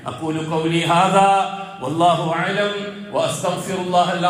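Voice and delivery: slow, low Arabic prayer intonation; slow devotional Arabic prayer; closing prayer. Acted. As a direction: close the sermon with a measured low invocation.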